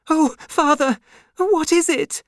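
high, loud panicked plea; terrified cry. Acted. Lucy hears a great knock, cries out terrified. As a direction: clutch at father in fear. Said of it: "cried Lucy".

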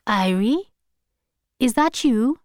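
lightly voiced grandmother on the phone; questioning, lifted character voice; lightly voiced Hortense. A narrator's reading. Grandmother checking who picked up the phone, questioning. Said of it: "said Hortense's voice".

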